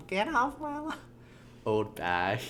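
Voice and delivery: thin, high-pitched old person attempt. Shown as a demonstration. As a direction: try a falsetto old person. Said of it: "forgetting how old people talk".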